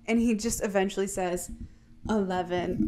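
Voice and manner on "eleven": low, subdued retold answer; a mild, defeated murmur; weak reluctant admission. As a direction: give it up softly, as if compelled. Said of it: "He's so weak right now".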